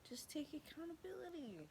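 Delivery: high, swooping sing-song. Real, not acted. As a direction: lilt it up high, then swoop down. Mock-cheery advice to viewers.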